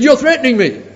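high, loud indignant claim; imitated indignant caller; indignant accusation. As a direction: insist you're under threat. Atheist insists.

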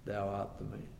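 low, soft grave verdict; prophet's soft grave charge to the king; solemn accusation. Acted. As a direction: quietly deliver the blow. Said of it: "Nathan said to David".

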